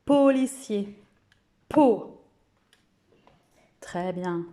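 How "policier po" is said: policier high and loud, then Po; teacher giving an example word. Shown as a demonstration. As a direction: sing out policier.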